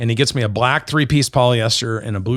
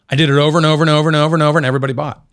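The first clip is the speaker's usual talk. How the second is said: fast and louder, rattling off the repeats; excited rush; 5 semitones higher, 6 dB louder, much faster than usual. Real, not acted. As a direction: tumble the words out, thrilled. Excited retelling of his sales success.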